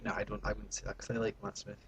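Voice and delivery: fast whisper; quick whispered retraction. Acted. Taking back his joke about Matt Smith in a fast whisper. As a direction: hurriedly whisper the take-back.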